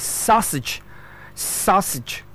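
"sausage" as SOSS-ij, short last syllable; correct pronunciation. Shown as a demonstration. Teacher modelling S A U S A G E, careful pronunciation. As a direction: say "sausage" carefully, stress first. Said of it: "and that is".

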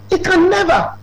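emphatic high insistence. Acted. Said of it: "said twice, first take".

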